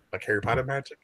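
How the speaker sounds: ordinary talk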